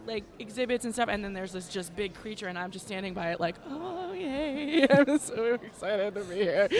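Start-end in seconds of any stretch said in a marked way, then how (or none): 3.7-6.7 high, drawn-out mock squeal of delight; retold giddy excitement. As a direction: gush slowly like a thrilled fan, acted out. Acting out her delight at exhibit.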